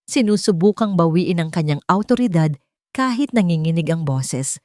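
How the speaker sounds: plain reading voice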